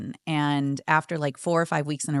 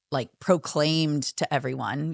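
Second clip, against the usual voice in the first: usual speaking voice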